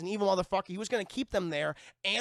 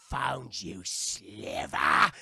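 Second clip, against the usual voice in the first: menacing villain voice; 9 semitones lower, 6 dB louder, much slower than usual. Retold or imitated. Ben menaces the heroes.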